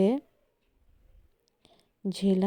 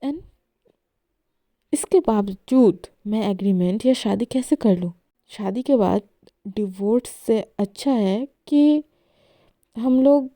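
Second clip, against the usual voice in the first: angry outburst; 4 dB louder than usual. Acted. Esha quarrels, wants breakup.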